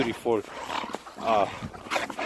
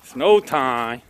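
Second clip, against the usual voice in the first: ordinary talk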